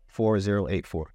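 ordinary talk, nothing marked

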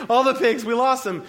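high, loud, frantic reply; panicked insistence. Acted.

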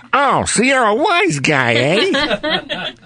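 put-on high tough-kid wise guy voice; squeaky little-rascal toughness; Robert Blake as Mickey. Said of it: "like Robert Blake when he used to play Mickey".

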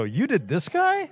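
high, drawn-out incredulous question; amazed disbelief. Acted. Imagined listener hearing who played the role, amazed disbelief. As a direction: act out an astonished listener, pitch way up.

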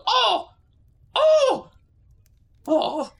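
very high, loud squealing oh; excited squeals. Acted. Bido on the table, thrilled by the centipedes. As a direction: squeak with childish glee.

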